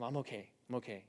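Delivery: softly insisting he is fine while shaking; a quiet, unconvincing I'm okay; hushed reassurance. Retold or imitated. Shaking, reassuring his wife.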